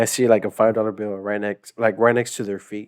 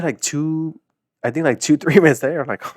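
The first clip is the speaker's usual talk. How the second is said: usual speaking voice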